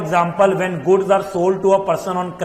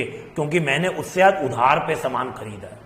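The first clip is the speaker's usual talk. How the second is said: ordinary delivery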